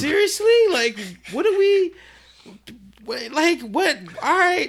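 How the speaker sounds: high-pitched, incredulous sputter; exasperated disbelief. Retold or imitated. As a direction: sputter as if nothing makes sense. His exasperated reaction, retold.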